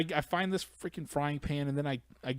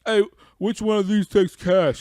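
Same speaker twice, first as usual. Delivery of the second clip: put-on customer voice; 8 semitones higher, 11 dB louder than usual. Clueless customer at the checkout, hammed up. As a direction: ham up the clueless guy. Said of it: "and he says".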